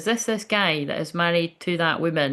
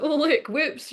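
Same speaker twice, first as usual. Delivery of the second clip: much higher, breathy-free fake innocent squeal; mock Jezebel; 11 semitones higher, slower than usual. Mocking Jezebel women who trap men, much higher. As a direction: ham up a sly flirt pretending surprise. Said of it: "Jezebels that be like".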